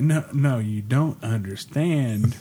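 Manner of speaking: deliberate, drawn-out correction; slow insistence. Retold or imitated. Brother explaining password order to bartender, slow and insistent. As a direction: stress each word slowly so it sinks in.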